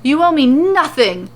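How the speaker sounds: insist, scandalized; hammed-up affronted shopkeeper; appalled. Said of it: "Morrow is appalled".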